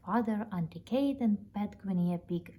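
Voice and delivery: ordinary delivery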